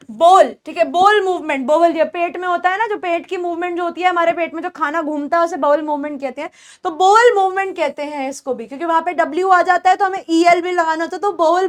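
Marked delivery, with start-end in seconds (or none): none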